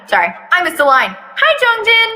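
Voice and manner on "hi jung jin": higher, lighter voice for the uncle; high Jung-jin voice. Acted. Jung-jin greeting Taehyung from the car, pitched up. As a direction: greet the kid brightly, pitched up.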